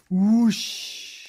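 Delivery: long, loud swooping whoosh. A narrator's reading. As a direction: draw out a big whoosh of wind. Story sound effect, loud swoop.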